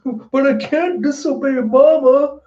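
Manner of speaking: high, stammering crybaby voice; tearful whine. Retold or imitated. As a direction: imitate a crying little cloud pleading. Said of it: "poor Zeus is just crying".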